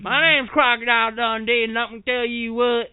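Crocodile Dundee impression. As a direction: try the Australian accent and slip into a hillbilly voice. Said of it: "That's not how he speaks".

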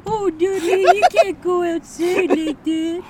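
high, loud, slow Baltimore parody; silly Boston-Southern Baltimore mash-up; mock Baltimore accent. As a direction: wail it in a hammed-up Baltimore accent. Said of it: "the Baltimore accent that also was".

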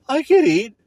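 high, mocking whine; mock the lazy answer. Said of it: "It's like".

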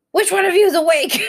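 retold yell at the kids, half-laughing; mad yelling. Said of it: "so mad, just yelling".

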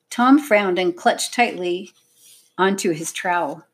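ordinary delivery

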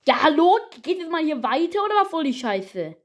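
high, loud angry rant; freaking out. Real, not acted. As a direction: rage at the stuck game. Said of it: "ich bin gerade eben, glaub ich, ausgerastet".

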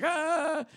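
high, small drawn-out scream; mock scream. Waking mid-fall off a cliff, mock scream. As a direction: imitate a falling scream without full volume. Mickey wakes while falling off cliff.

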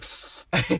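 a long unvoiced psst for a lisped S; lisping hiss. Podcaster mocking their own lisp with a hissed psst. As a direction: hiss the S through the teeth like a lisp. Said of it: "it's always like".

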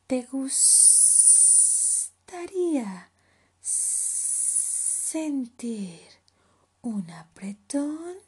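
long, drawn-out s sounds; slow sibilant snake voice; hissing python. Acted. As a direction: slither through it, stretching every hiss. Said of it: "siseó Pitón".

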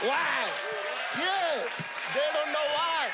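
high, loud preaching cry; triumphant shout. Real, not acted. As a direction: shout it out to the church.

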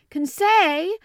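loud rising shout. A narrator's reading. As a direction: shout his name for the third time. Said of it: "I shouted for the third time".

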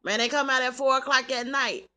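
ordinary delivery

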